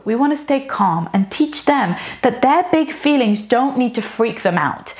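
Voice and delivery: usual speaking voice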